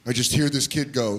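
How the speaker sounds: ordinary talk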